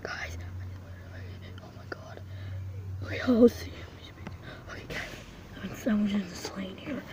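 soft hiding whisper. Real, not acted. Stuck hiding in the lane, whispering to the viewers. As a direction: barely breathe the words out.

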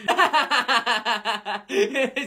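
long, unrestrained giggling; laughter. Real, not acted. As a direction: burst out laughing and keep it going. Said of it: "de qué tanto se están riendo". (carcajada larga)

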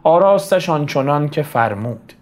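lifted declamation falling at rhymes; poem recitation. Acted. Teacher recites the mother adorning dead Layli, declaimed poem. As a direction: recite the elegy in measured couplets. Recites verse, then explains.

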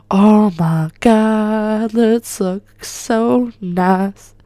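very slow, drawn-out gushing; awestruck gushing. Real, not acted. Gamer admiring the Ferrari, gushing slowly. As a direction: stretch every word in awe.

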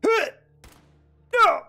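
ordinary delivery

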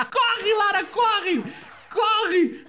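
high shrieked panic with laughter breaking through; shrieking panic. Real, not acted. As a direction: scream at her to run from the monster. Foge do monstro em pânico. (grito agudo de pânico, meio rindo)

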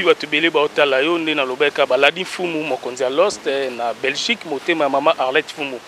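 ordinary talk, nothing marked

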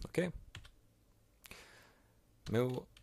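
normal talking voice

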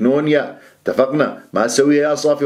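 usual speaking voice

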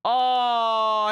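surprised shout. Real, not acted. Sudden shout at game bug.

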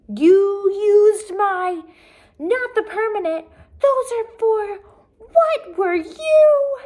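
high, loud, slow broken-off outbursts; sputter, too upset to finish; sputtering upset mother. A narrator's reading. Mother finding her markers used, loud and sputtering.

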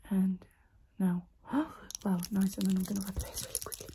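close-mic whisper, guiding the listener; ASMR whisper. Acted. Whispering to the viewer during an ASMR roleplay.

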